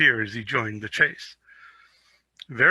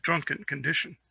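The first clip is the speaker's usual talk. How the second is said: ordinary delivery, nothing marked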